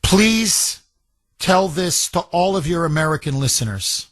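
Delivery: grave reading with long, heavy pauses; solemn, moved letter reading. Acted. Host reading a British listener's farewell letter, solemn and moved. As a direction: let each sentence land, holding back emotion.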